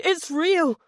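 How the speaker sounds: high panicked scream. Acted. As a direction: scream It's real in terror. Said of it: "Jack's mind screamed".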